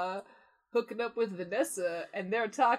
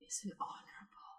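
whisper; 11 dB quieter than usual. Pretend. Playful whispered quote.

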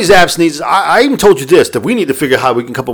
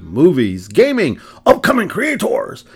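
ordinary talk, nothing marked

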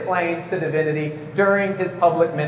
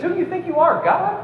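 much higher, mock-indignant "who do you think you are"; incredulous challenge; 10 semitones higher than usual. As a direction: throw the question back in scornful disbelief. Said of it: "I want to say to this Jesus".

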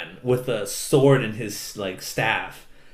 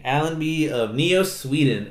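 ordinary talk, nothing marked

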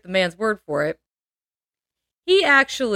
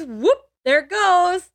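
high, slow fake-clumsy oops; mock oops; 9 semitones higher, slower than usual. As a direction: play the fake accident lightly, slowly. Husband drops rock mock.

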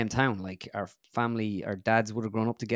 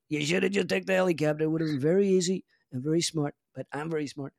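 high, loud Trump voice, boastful and rambling; Trump impression; 6 semitones higher, 3 dB louder than usual. Comedian doing his Trump for the interviewer, loud and boastful. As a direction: do Donald Trump bragging to a golf impressionist. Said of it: "I have a bit of a Trump".

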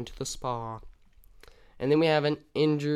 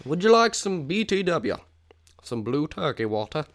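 high, loud offer from a Mos Espa alien; pushy alien merchant calling out to passersby; alien vendor voice; 4 semitones higher, 5 dB louder than usual. Acted. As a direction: hawk it like a street vendor on another planet. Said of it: "someone at the Mos Espa would ask you".

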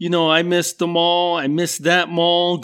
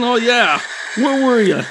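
mock scolding mom; 6 semitones higher than usual. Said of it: "she was like".

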